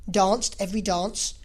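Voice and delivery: ordinary delivery, nothing marked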